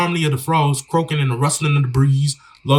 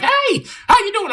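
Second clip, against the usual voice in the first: much higher, slow mock sweet greeting; 3 dB louder than usual. Imitating a girl who walks up chatting him up, in a slow falsetto. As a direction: lay on a syrupy high falsetto, drawn out. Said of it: "a chicken head comes up to you and is like".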